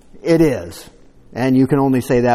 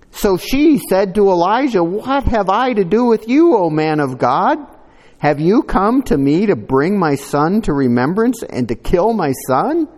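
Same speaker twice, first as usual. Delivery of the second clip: raised, louder reading voice; solemn Bible reading; scripture reading; 6 semitones higher, 5 dB louder than usual. Acted. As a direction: read the passage out to the church. Reads scripture aloud.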